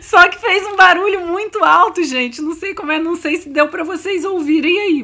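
talking while laughing. Real, not acted. Laughing about her dog's fart.

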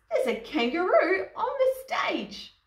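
very high, loud acted outcry; storybook yell from startled dancers; astonished yell. A narrator's reading. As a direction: raise the alarm in shocked disbelief. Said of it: "yelled the dancers".

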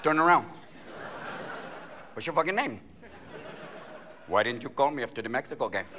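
low, intimate coaxing; sweet referee. Acted. Referee flirting with a soccer player, sweet and low. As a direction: murmur it like a flirty official. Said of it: "the referee is so sweet".

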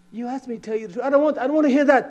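high, loud, fast mock wife; voicing his wife. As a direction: rattle it off as the wife. Wife objects to truth.

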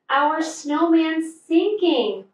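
high, slow alarmed cry; alarmed yell. A narrator's reading. Fluff yells to his friends, alarmed. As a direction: sound the alarm over the snowman. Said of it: "Fluff yelled".

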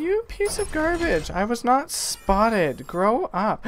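high-pitched, drawn-out protest; mock indignation. Player argues with game about being spotted, mock indignant. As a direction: scold the game in hammed-up outrage.

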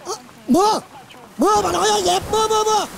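high, hurried alarm at the downpour; urgent call about rain. Acted. Man alerting the household to the sudden rain, high urgent calling. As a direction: sound the alarm, rain's coming, grab everything.

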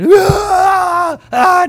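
high, loud panicked screams; hammed-up Wicker Man scream; Nicolas Cage screams. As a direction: shriek in hammed-up terror like Cage. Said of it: "Nicolas Cage from Wicker Man".

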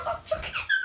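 very high, soft laughing squeal; giddy squeal. Real, not acted. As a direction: squeak it out through giggles. (gülerek, incecik heyecan çığlığı)

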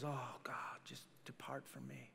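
hushed, voiceless plea; humble whisper. Acted. Peter begging the Lord to leave him, whispered. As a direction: whisper it like a broken man on his knees.

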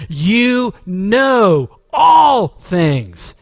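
very slow, loud and high; drawn-out cry. Retold or imitated. Preacher voicing Peter's confession to Jesus, loud and slow. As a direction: stretch every word out with fervor.

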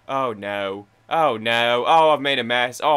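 rising loud oh no panic; loud dismay. Real, not acted. Player knocking sweet rolls everywhere, in loud dismay. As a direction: yelp at the mess he's made. Knocking items over in game.

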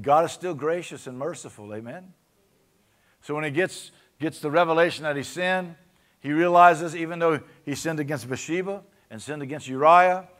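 loud, high, impassioned preaching; full-force preaching. Acted. Pastor fires up the church about sin and mercy, loud high preaching. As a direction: build it to a shouting climax.

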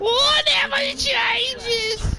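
very high and very slow; squeaky high voice. Acted. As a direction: squeak it out mockingly. Mocking the famous line.

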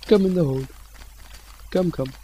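high little pet-calling voice; high-pitched coaxing. Pretend. Luring a creeper into the hole, coaxing. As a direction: coax it in, high and light.

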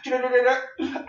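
high rolled gurgle; imitated pouring sound. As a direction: mimic oil trickling fast. Imitates oil pouring into vessels.

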